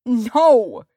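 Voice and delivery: high, loud desperate refusal; cried out. A narrator's reading. Hobbit pinned by the warrior, crying out. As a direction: yell it in alarm. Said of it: "Frodo cried".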